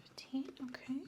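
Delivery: soft whispered echo of the answer; doctor whispering the patient's answer back; whispered repeat. Acted.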